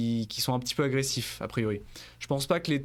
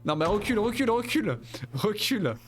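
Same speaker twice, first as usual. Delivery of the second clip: high-pitched yells at the enemy; excited yelling; 10 semitones higher than usual. Real, not acted. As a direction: shout it up high, worked up.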